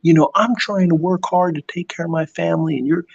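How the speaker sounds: a hint of a hurt worker standing up; Bob's reply. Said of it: "He's like".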